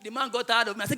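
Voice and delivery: ordinary talk, nothing marked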